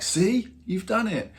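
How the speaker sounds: high slow teasing; drawn out, high, told-you-so; teasing bandmates. Retold or imitated. Bandmates teasing Rob after meeting idol, high slow. Band congratulating him.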